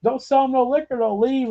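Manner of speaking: lightly hammed-up high repeat; high mock plea. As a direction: toss it up high as a joke.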